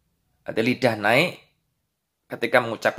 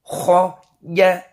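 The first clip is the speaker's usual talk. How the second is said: higher, louder drill recitation; reading drill; 6 semitones higher, 4 dB louder, much slower than usual. Shown as a demonstration. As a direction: chant each syllable for pupils to repeat. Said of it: "Halaman dua belas dibaca".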